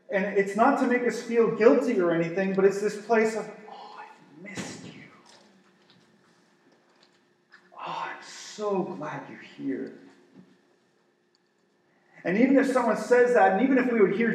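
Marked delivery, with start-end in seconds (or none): 3.7-9.9 tender. Acted. Jesus voiced tenderly welcoming.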